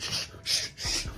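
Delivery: soft voiceless shushing; playful, conspiratorial "shh"; mock hushing. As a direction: shush them as if guarding a secret. Pretends to share a secret.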